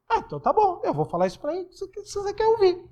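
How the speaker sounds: high, soft mock resignation; ironic. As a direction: give in with a sarcastic shrug in the voice. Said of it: "Só que vai ser irônico da minha parte".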